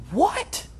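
high, rising incredulous "what?"; indignant disbelief. Acted. Preacher voicing his first response to suffering, indignant disbelief. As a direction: balk in disbelief, pitch shooting up. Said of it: "my natural response, my first response".

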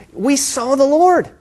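high, drawn-out joyful report; acted wonder, pitched well up; excited announcement. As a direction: share astonishing news, voice lifted and slow. Disciples telling Thomas the news.

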